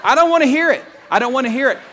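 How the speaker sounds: loud, high, dismissive outburst; defiant shout. Real, not acted. Preacher cutting off animal-lover critics, loud and defiant. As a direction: shout the crowd down before they object.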